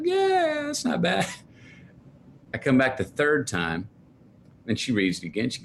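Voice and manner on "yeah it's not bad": high, offhand mom voice; imitating mom. Mom brushing off son's poem, high offhand voice. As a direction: toss it off like his unimpressed mom.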